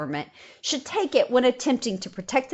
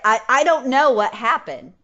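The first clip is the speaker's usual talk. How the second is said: usual speaking voice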